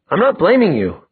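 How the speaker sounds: high-pitched protest. Acted. Protest in quarrel.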